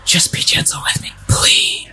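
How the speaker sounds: fully breathy, voiceless whisper; whispered plea. Acted. Woman begging her lover to be gentle, whispered. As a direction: whisper it, pleading softly. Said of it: "she whispered".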